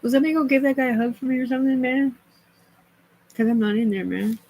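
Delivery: fast, high and loud rant; rushed high excited yelling; excited outburst. Real, not acted. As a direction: blurt it out all at once.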